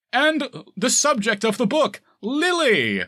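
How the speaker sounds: slow, high, loud announcer intro; talk show announcer. Retold or imitated. Podcaster imitating a talk show announcer listing the guests, slow high hype. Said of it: "talk show gimmicks".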